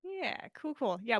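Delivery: plain reading voice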